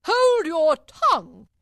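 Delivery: high, very loud, drawn-out order; furious Queen. A narrator's reading. Queen silences Alice, furious shout.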